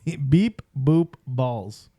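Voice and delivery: slow announcement, word by word; slow, deliberate title. Real, not acted. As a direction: sound out the title slowly. Sounding out the episode title.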